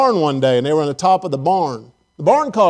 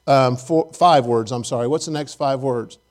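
normal talking voice

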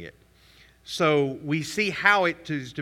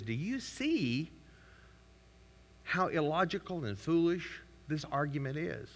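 mock mirror question; 9 dB quieter than usual. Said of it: "hold up a mirror that says".

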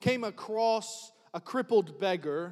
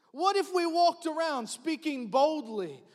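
normal talking voice

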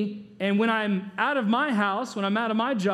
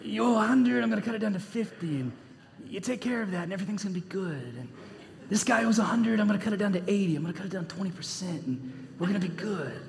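mock mobster deal-maker, dropping softer as he goes; mafia voice; 4 dB quieter, faster than usual. Preacher preaches as the mobster steward cutting debts, mafia voice. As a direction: play the mafia impression, trailing into a hush. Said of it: "I should preach like this".

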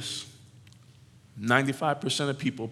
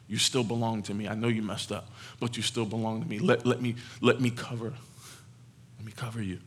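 tender; 2 semitones lower, 6 dB quieter than usual. Acted. God reassuring the lost child.

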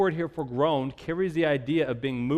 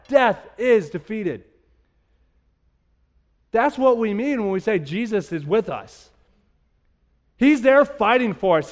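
rising, louder sermon build; preaching; 5 semitones higher, 5 dB louder than usual. Acted. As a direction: preach it with fire and conviction. Preacher building to full force.

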